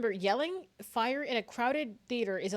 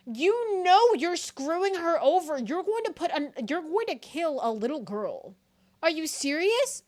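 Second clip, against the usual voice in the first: loud, high-pitched protest; indignant outburst; 6 semitones higher, 7 dB louder than usual. Real, not acted. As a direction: scold them loudly in disbelief. Indignant at the damning testimony.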